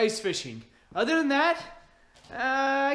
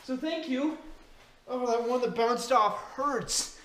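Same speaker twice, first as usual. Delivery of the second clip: usual speaking voice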